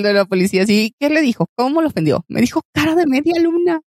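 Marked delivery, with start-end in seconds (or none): 2.3-3.8 much higher, whiny tattling tone; the man complaining to police; mock complainer. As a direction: whine like an offended man.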